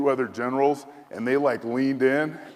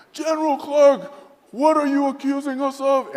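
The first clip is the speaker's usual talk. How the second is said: very high, loud indignant imitation; outraged general; 7 dB louder than usual. General outraged at the coup accusation, high and loud. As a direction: demand it as the offended general.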